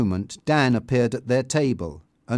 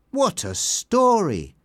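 higher, slow exclamation; impressed exclamation; 8 semitones higher, slower than usual. A narrator's reading. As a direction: marvel at the news, drawn out. Dan exclaims over newspaper report.

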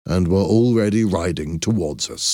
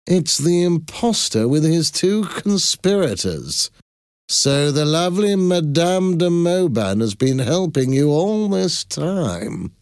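high sneering villain voice, the last words stretched slow; mocking Rupert taunts the trapped conspirators, gloating; 11 semitones higher than usual. A narrator's reading. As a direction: savor the catch like a smug villain. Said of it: "he exclaimed".

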